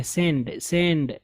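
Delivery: "send" with a short e, not the a of sand; teacher showing how "sand" should sound; corrected vowel. Shown as a demonstration. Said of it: "आप sand नहीं पढ़ेंगे".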